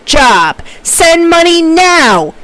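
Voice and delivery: exasperated, high-pitched demand in slow, punched words; angry, aggravated, frustrated. Acted. Professor acting out Amy's email to a coworker, angry and frustrated. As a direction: act out a fuming email, dragging out key words. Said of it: "I blew out your eardrums".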